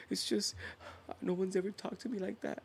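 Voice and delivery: high, soft mock-wounded voice; imitating her small, pleading voice; meek, hurt. Acts her meek reply after scolding.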